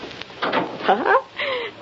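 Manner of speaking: short knowing chuckle, breathy and high; amused laugh of understanding. Acted. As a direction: laugh as the hint lands. Clicker gets the hint.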